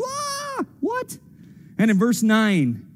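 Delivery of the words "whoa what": very high, soft startled whoa; high-pitched disbelieving what; astonished. Pretend. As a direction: gasp out a shocked whoa. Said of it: "I wonder if they're like".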